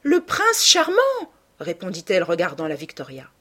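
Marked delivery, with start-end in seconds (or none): 0.0-1.0 high, loud, thrilled cry; excited, ringing answer; elated exclamation. A narrator's reading. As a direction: announce the beloved with delight, loudly. Answers excitedly on sighting her beloved.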